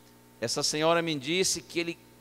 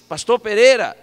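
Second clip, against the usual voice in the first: loud, raised call; chamando mais alto; 5 semitones higher, 12 dB louder than usual. Retold or imitated. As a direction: call loudly to someone hard of hearing. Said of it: "encostei mais e disse". (chamando alto, em voz firme)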